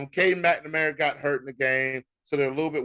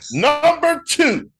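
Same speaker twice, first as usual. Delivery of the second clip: much higher, louder, slow drawn-out announcement; announcer call; 12 semitones higher, 6 dB louder, much slower than usual. Acted. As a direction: announce it like a stadium ranking reveal. Announcing the ranking.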